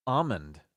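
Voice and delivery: ALMOND as AH-mund, falling. Shown as a demonstration. Host testing the strange version, ALMOND said AH-mund. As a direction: try out ALMOND with no L. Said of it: "That doesn't even sound".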